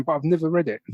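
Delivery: ordinary talk, nothing marked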